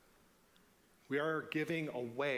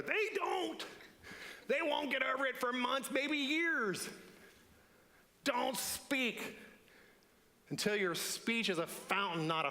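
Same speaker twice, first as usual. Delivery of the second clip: a higher, urgent conscience voice cutting in; higher-pitched warning voice; inner voice; 9 semitones higher than usual. Acted. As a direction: stop yourself before you say it. Inner voice argues against speaking.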